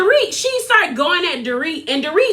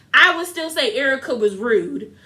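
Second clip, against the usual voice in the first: usual speaking voice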